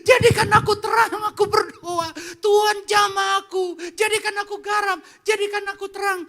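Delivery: hammed-up begging prayer; mock tearful prayer. Mocking believers who weep and beg God in church. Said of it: "nangis-nangis sama Tuhan dan bilang gini".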